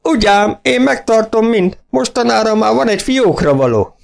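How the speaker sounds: boasting farmer. A narrator's reading. Farmer boasting to the young vets, high and delighted. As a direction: show off the hoard with glee.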